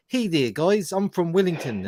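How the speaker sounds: lightly voiced Wellington Kiwi accent. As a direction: put on a broad New Zealand accent. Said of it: "a guy from, uh, Wellington. He'd be like".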